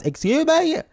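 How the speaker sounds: squeaky disbelief; indignant surprise. Real, not acted. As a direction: jump up in pitch, mock offended. Reacting to chat demand.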